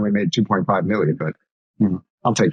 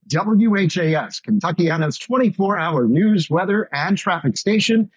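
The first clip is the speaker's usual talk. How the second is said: higher, slow radio news announcer; newscast sign-off; 6 semitones higher, slower than usual. Shown as a demonstration. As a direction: sell the station ID like a practiced broadcaster. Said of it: "my sign out for one of my newscasts".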